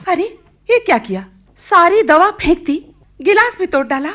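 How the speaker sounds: high, loud dismayed cry at the mess; shocked outcry. Acted. Mother shocked that daughter threw medicine, loud dismayed cry. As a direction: react to the smashed glass in alarm.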